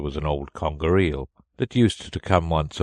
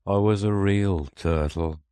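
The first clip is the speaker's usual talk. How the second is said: mournful creature lamenting what he was; deep sigh; 3 semitones higher, 4 dB louder than usual. A narrator's reading. Mock Turtle recalling his past to Alice, sighing mournfully. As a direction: draw out a sorrowful lament. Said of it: "with a deep sigh".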